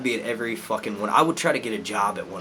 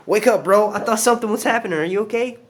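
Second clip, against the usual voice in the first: loud concerned; 10 semitones higher, 7 dB louder than usual. Real, not acted. Checking on the dog.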